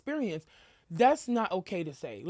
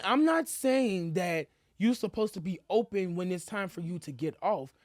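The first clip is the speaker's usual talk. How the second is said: normal talking voice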